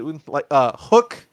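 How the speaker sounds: ordinary talk, nothing marked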